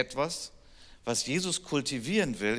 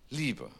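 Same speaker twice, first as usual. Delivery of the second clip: Liebe said alone after a pause, softer with a falling tune; stress on Liebe; 4 dB quieter than usual. Shown as a demonstration. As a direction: let Liebe land softly as the key word. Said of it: "Die Betonung ist immer".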